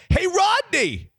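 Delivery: high-pitched excited call-out; excited yell. Retold or imitated. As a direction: holler a name in excitement, pitch leaping up. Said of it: "and I yell".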